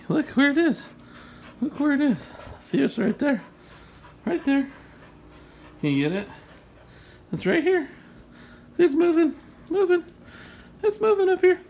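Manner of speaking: high-pitched eager coaxing; excited dog talk. Real, not acted. Owner showing dog the moving toy, high and excited. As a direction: get a pet excited in a high voice.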